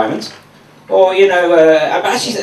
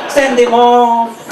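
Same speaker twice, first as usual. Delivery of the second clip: high, whining complaint to the ref; whiny footballer; 6 semitones higher than usual. Retold or imitated. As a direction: whine like a diving player.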